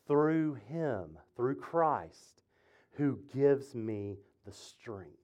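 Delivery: soft, slow, weighted reading; slow verse reading. Acted. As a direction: read it low and slowly, word by word. Reading the verse slowly, reverently.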